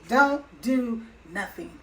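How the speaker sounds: slow, each word weighted: "Don't do nothing."; repeating "Don't do nothing." slowly to stress it; slow emphatic repeat. Shown as a demonstration. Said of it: "His exact word".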